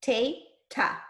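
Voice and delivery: TE and TA as two separate beats; separated syllables. Shown as a demonstration. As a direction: leave a gap between TE and TA. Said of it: "What does it say here?".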